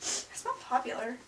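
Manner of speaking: breathy whispered retort; whispered comeback. Acted. Nerd whispering a comeback at the mean girl. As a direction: hiss it back under your breath. Repeated skit take.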